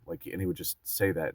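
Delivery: normal talking voice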